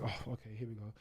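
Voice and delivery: under-the-breath here-we-go; resigned aside. Retold or imitated. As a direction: drop right down, bracing for hassle. Voicing his reaction to being doubted.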